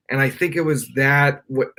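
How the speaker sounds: ordinary talk, nothing marked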